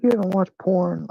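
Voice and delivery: high mock-incredulous woman; incredulous friend. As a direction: play the shocked friend asking. Friend's incredulous question voiced.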